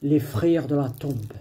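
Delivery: usual speaking voice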